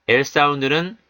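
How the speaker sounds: ordinary talk, nothing marked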